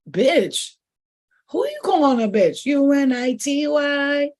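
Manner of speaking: loud, high mock clapback; angry comeback. Answering someone who cursed at her, a loud mock clapback. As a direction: spit it back at them, spelling it out slowly. Snapping back at a rude person.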